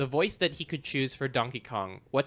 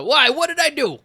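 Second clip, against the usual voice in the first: character voice; 13 semitones higher, 10 dB louder than usual. Retold or imitated. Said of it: "he says".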